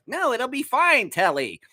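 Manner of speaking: high, slow Muppet voice; high-pitched cartoon reassurance; reassuring puppet friend. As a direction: soothe a worried pal in a puppet voice. Voicing Bird reassuring Telly.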